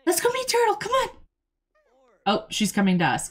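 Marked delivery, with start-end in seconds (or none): 0.1-1.1 much higher, sing-song coaxing; very high pet talk to the dog. Real, not acted. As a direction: coax the dog along in a very high voice.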